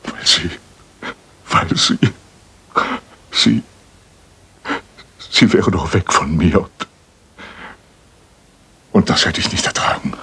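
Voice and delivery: stumbling over words, near tears; a father confessing, barely able to finish, voice breaking; halting, choked confession. Acted.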